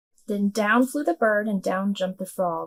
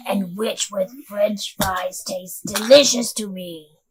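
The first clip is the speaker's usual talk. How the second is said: loud, menacing dragon voice; scary dragon; 5 dB louder than usual. A narrator's reading. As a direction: boom it out like a mean dragon. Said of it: "a roar that was scary and loud".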